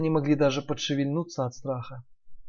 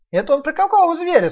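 high squeaky piglet; 13 semitones higher, 8 dB louder than usual. Acted. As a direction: ask high and loud like a piglet. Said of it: "спросил Ниф-Ниф".

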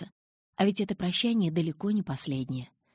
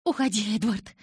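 loud, higher, crying out his name; wailing shout; 5 semitones higher, 6 dB louder than usual. A narrator's reading. Bella wails at Edward to go, loud. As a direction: wail it at him as the door slams. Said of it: "заголосила я".

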